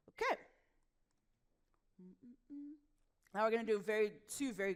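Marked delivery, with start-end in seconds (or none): 2.0-2.7 short soft humming, higher than speech; soft closed-mouth hum. Shown as a demonstration. Coach before vocal warm-ups, humming softly. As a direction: hum gently on "mm". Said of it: "The first one is a hum".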